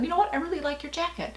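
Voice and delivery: lifted, eager friendly praise; retold cheerful compliment to a shopper; bright compliment. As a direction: pay a stranger a happy compliment, voice up high.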